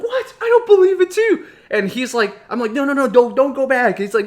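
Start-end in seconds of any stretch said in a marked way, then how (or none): 0.0-1.5 high, loud mock disbelief; incredulous friend. Friend hears the knife rumor and won't believe it, incredulous. 2.7-4.0 raised, louder mock-panicked plea; urgent warning. Boy warns his friend not to go back in, panicked.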